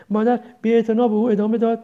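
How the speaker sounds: ordinary delivery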